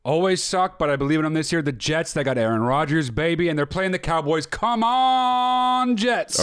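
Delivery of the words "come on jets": high, stretched-out rallying cry; fan cheer. Pretend. Fan rooting for his team, high drawn-out cheer. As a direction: hold the "on" long and high.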